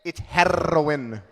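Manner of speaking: put-on loud Spanish-accented correction, slowed on heroin; mock mom fixing the word; mom correcting pronunciation. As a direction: snap then drawl it like mom. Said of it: "She's like".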